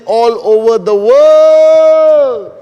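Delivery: high, stretched-out calling greeting; slow, booming welcome to the crowd; grand announcer greeting. Acted. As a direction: hail the whole world like a host opening a show. Opening greeting to global audience.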